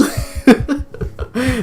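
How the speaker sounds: loud high-pitched laughter; squealing laugh. Real, not acted. Laughing after outburst.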